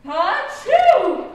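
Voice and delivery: big loud sneeze. Acted. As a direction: sneeze hard. Duchess sneezes loudly.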